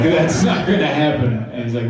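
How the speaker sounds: ordinary talk, nothing marked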